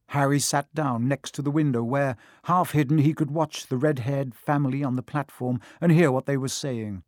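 plain reading voice

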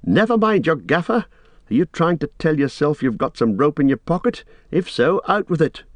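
high-pitched, impatient prodding; Frodo amused and annoyed. A narrator's reading. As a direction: chide a friend with some amusement. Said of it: "to feel both amused and annoyed".

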